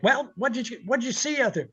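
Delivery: high, demanding boss voice; mock impatient owner; lightly voiced pushy boss; imitating the boss. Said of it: "Larry calls back".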